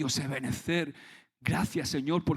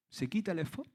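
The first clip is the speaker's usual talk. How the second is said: normal talking voice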